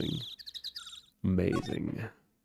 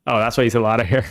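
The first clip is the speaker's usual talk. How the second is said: ordinary delivery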